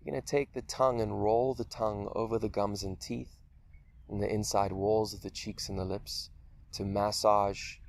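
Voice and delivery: unhurried meditation voice with long pauses; guided meditation. Acted. Teacher leading listeners through a guided meditation, calm and spacious. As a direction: guide them gently through a body scan, leaving space between phrases.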